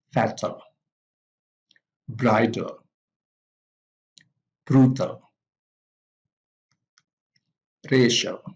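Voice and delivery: FA-tal, BRI-dal, BRU-tal, RA-cial, CEN-tral, each stressed at the front; first-syllable stress. Shown as a demonstration. Teacher models adjectives ending in L, first-syllable stress on fatal, bridal, brutal, racial, central. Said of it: "stress is also on the first syllable".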